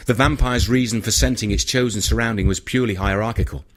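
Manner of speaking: plain reading voice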